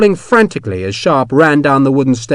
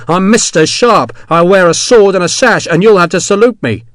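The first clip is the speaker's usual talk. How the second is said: higher, louder gloating; gloating boast; 7 semitones higher, 6 dB louder than usual. Acted. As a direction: crow over him proudly. Sharp boasting.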